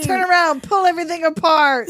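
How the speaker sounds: loud, high hammed-up command; mock nurse's order. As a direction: shout orders like a brusque nurse. Acting out the spray-tan nurse.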